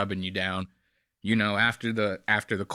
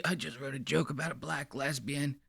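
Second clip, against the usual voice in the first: soft, far-off imitation of the smug comic; mocking the comedian; 10 dB quieter than usual. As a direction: mimic his giddy confession. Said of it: "he told it to her like this".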